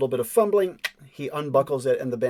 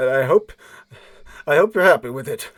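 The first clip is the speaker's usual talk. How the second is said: resentful surrender; 9 dB louder than usual. Acted. Beaten man resentfully surrenders.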